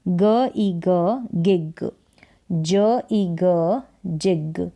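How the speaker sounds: slow phonics, each sound alone, then "गिग", "जिग" blended; sounding out short i. Shown as a demonstration. Teacher reading small spellings to learners, "ग ई ग" slowly sounded out, then "गिग". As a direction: draw out "ग ई ग" one sound at a time, then "गिग". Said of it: "आई को ई पढ़ रहे हैं".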